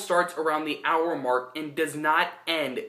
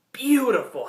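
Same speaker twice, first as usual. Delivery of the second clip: higher, louder burst of praise; excited exclaim; 7 semitones higher, 4 dB louder than usual. Real, not acted. Reviewer gushes over the dragon, excited exclaim. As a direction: let it burst out with delight.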